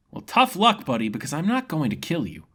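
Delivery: a carefree fighter enjoying the duel; grinning swordsman impressed by his foe; cheerful young fighter. Acted. Ren replies.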